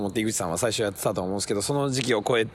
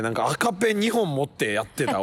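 ordinary delivery

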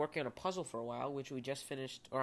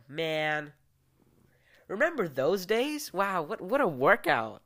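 mock nostalgic; 4 semitones higher, 7 dB louder than usual. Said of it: "I would just go".

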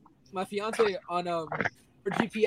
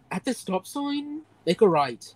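annoyed Irish Siri; 3 semitones higher than usual. Retold or imitated. Fiancée's GPS giving directions, annoyed Irish accent. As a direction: imitate a fed-up Irish GPS voice. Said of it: "it sounds so annoyed".

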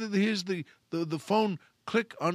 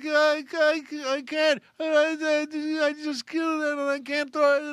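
very high hysterical mock wail; acted hysterical maid; even more upset; 11 semitones higher than usual. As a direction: blubber and stammer in one long whine. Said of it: "she gets even more upset".

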